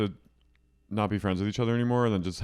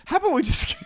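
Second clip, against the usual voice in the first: high, loud tipsy squeal; drunk Miranda; 5 dB louder, much faster than usual. Retold or imitated. Miranda pushing the girls toward more wine, loud tipsy squeal. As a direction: squeal it like the tipsy friend egging everyone on. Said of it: "Miranda goes".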